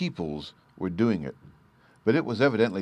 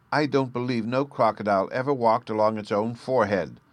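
cried; 5 semitones higher, 4 dB louder than usual. A narrator's reading. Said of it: "Sylvie cried".

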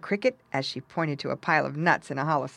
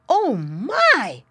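high, loud piglet exclamation; startled little pig; alarmed cry; 7 semitones higher, 8 dB louder than usual. A narrator's reading. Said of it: "cried Squinty".

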